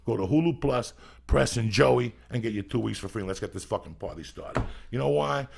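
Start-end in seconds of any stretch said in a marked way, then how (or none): 0.1-3.2 punchy, louder profane sponsor pitch; hard-sell ad read. Real, not acted. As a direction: push the sponsor hard, hammering the web addresses. Reads live sponsor pitch.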